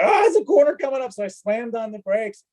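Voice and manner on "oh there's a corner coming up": high, fast startled cry; fast alarmed realisation; sudden alarm. Retold or imitated. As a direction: blurt it out like you just spotted the bend. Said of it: "and I'm like".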